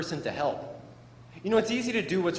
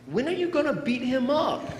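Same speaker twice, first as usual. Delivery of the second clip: put-on confused kid; 9 semitones higher than usual. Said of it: "Now, I was confused".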